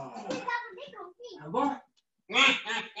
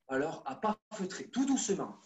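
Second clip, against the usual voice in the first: softer and lower, hushed guidance; 6 semitones lower, 8 dB quieter than usual. Shown as a demonstration. Coach guiding kids on tiptoe past the keeper, hushed. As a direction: murmur so the keeper can't hear. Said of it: "mais chut".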